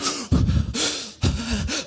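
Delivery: a sharp, high, frightened gasp; mock gasp for air, scared by the snake; imitating panicked gasp. Pastor re-enacting terror in his car, high gasping breath. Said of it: "I'm breathing like".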